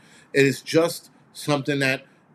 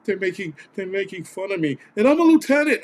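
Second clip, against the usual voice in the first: whiny mock voice, pitched higher, last line louder; mocking the fat Spanish lieutenant; 7 semitones higher, 3 dB louder than usual. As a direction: mimic a complaining firefighter, climbing into a high yelp. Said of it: "that one fat Spanish dude that was like".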